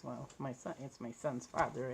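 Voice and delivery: ordinary talk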